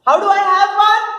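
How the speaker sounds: high loud stammering call; frantic stammering call; crying out. Acted. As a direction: call after him in a panic. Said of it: "Man cries out behind him".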